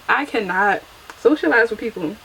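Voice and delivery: ordinary talk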